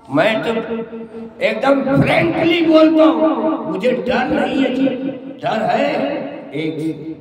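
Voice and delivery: building sermon climax; preaching at full force. Real, not acted. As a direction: roar it out to the crowd.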